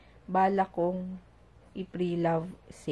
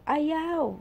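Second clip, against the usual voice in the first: put-on child's voice; 8 semitones higher than usual. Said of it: "Ganun naman siya".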